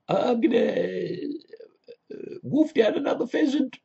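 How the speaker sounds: hammed-up toff planning to wolf down pheasant; silly posh persona.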